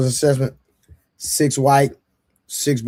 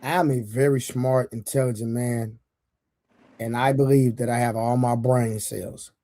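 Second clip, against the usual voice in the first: mock serious; 6 semitones lower, 4 dB quieter than usual. Real, not acted. Mock-serious slow put-down.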